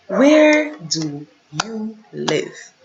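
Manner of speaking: Where do you live, very slow and deliberate; slow translation. Shown as a demonstration. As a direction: spell out the meaning slowly. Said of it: "Où habites-tu?".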